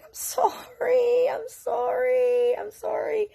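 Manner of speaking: very high, drawn-out little voice; high, stretched-out mock-scared apology; pleading kid apology. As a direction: plead slowly in a tiny kid voice. Scared nine-year-old apologises, retold.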